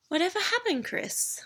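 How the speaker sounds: high, wide-eyed innocent question; sweetly feigned innocence; innocently. Acted. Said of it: "she asked innocently".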